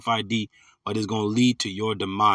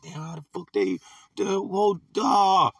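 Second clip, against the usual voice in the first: higher, dumbfounded onlooker, breaking off mid-question; mock bewilderment; 7 semitones higher, slower than usual. Preacher mimicking people who see the elect thriving, mock bewilderment. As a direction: play a gobsmacked bystander lost for words.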